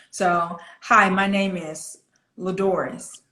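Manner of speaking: "my name is", short pause, then "Ladores", both falling; flat, in sections. Shown as a demonstration. Coach models the line for student, flat and broken up. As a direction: keep it level, with a period after "is" and after "Ladores". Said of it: "put a period there".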